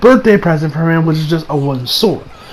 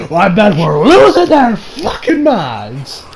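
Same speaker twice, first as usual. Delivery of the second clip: ordinary delivery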